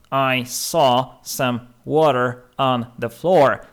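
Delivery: slow model sentence. Shown as a demonstration. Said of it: "Na przykład".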